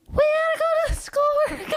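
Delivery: very high, squeaky helium voice; tiny squeaked helium-balloon pitch. Shown as a demonstration. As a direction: say it as if you've just sucked a helium balloon. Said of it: "sucking a balloon of helium".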